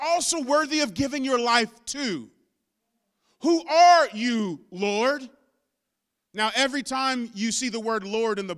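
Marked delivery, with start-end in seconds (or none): 3.4-5.3 awed question. Acted. Preacher voices Paul, slower take.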